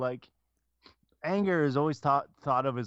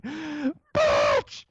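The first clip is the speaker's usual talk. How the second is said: high, loud screamed outburst; hammed-up enraged yell; mock scream; 4 dB louder, much slower than usual. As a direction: scream it like you finally let go. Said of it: "I'm just like".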